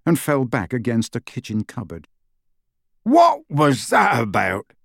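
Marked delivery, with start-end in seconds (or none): 3.0-4.7 slow, outraged roar in a put-on voice; Hagrid roaring. Hagrid demanding an explanation from Lupin, roaring. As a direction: bellow it in drawn-out protest. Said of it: "roared Hagrid".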